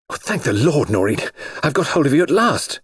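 high-pitched outburst of relief; relieved cry. A narrator's reading. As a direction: cry out as if you've finally caught up with them. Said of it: "he cried".

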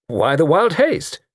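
slow, high repeated question; pointed, drawn-out asking; insistent repeat. Acted. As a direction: draw the question out to press it. But as I say.

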